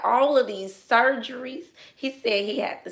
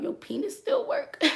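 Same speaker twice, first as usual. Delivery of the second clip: laughing; 11 semitones higher, 5 dB quieter than usual. Retold or imitated. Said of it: "I was like, asking him".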